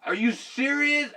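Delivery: loud high "Shit!" then disbelief; shocked curse. Retold or imitated. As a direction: blurt it out in shock. Quoting his shock in car.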